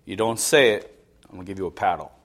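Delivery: parental warning. Retold or imitated. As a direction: quietly imitate a parent laying down a warning.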